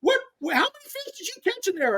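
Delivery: very high-pitched mocking taunt; hammed-up teasing impression, pitch way up; Roland Martin. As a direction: needle a rival in a squeaky put-on voice. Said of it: "Roland's chucking me up, like, laughing me up".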